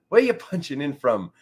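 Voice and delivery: ordinary talk, nothing marked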